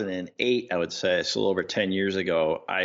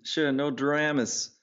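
slow, higher-pitched Aussie imitation; attempted Aussie accent; 6 semitones higher, slower than usual.

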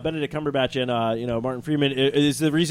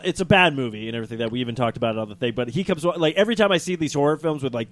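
ordinary delivery

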